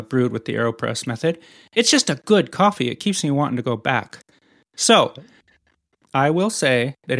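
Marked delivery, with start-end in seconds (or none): none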